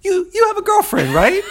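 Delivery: put-on shouted way up high, an insistent "You, you have a girlfriend, right?"; pushy tough guy. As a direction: bark it at him like an order. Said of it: "in my mind, it comes across like".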